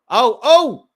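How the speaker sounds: loud, high burst of real excitement; real sudden yelp at the action; excited match reaction. Real, not acted. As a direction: cry out at a near goal. Reacting to a live shot.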